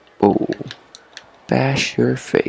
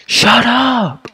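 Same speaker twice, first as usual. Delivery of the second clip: sudden high-pitched shout; high yelp; 13 semitones higher than usual. Real, not acted. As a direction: snap it out high. Yelling at [name].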